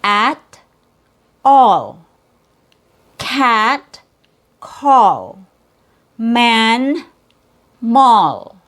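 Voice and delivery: teacher drilling vowel pairs; minimal pair drill. Shown as a demonstration. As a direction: contrast each pair clearly. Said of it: "Let's do it one more time".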